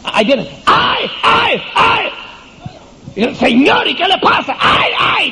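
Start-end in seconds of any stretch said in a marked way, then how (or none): 0.0-2.1 high, slow fake wails of distress; Potiphar's wife wailing to draw people in; mock cries. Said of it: "ella se puso a gritar". 3.4-5.3 high, shouted put-on lament; Potiphar's wife feigning distress to the servants; hammed-up distress. Sermon acting out Potiphar's wife.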